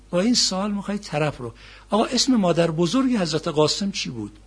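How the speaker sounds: ordinary delivery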